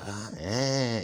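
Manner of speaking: loud, high "ah, ehhh" noise; imitated scary sound. Showing the sound his cousin made to scare him, loud imitated wail. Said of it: "It's the sound he made".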